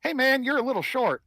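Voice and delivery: tiny halfling bluffing the big guy; cheeky sneer up at the tall human. Retold or imitated. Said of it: "and going".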